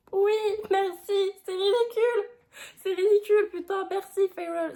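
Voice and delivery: high, loud gleeful approval; delighted cheer. Real, not acted. Vlogger cheering a book heroine's retort, high and delighted. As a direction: cheer her line on, voice up high.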